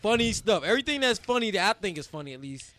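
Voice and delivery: ordinary talk, nothing marked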